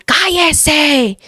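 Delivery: high, stretched-out order to shut up; possessed son's yell. Retold or imitated. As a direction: imitate a possessed son yelling through a door. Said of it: "desde adentro de su cuarto somataba la puerta". (imitando el grito agudo de un endemoniado)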